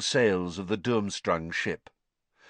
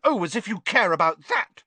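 put-on scoffing girl; 10 semitones higher, 4 dB louder than usual. A narrator's reading. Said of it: "scoffed Hermione".

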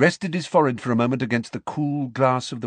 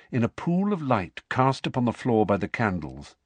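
usual speaking voice

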